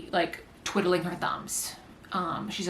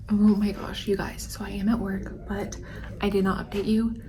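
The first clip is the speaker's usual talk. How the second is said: whispering. Real, not acted. Whispering while at work.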